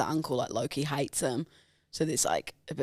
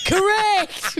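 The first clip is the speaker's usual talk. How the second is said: mock triumphant correct; 8 dB louder than usual. Fake confirmation of wrong guess.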